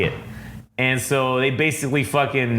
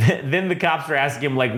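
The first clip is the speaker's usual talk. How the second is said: ordinary delivery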